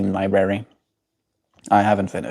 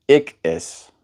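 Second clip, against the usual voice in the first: Cape accent "Ik is" with a raised vowel; 5 semitones lower than usual. Shown as a demonstration. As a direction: show how K P speakers say "Ik is". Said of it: "in the K P accent".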